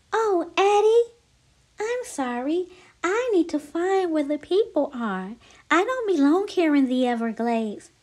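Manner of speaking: small, sorry snake; apologetic little snake. Acted. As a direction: plead gently in a high voice. Lucy apologises to Addie.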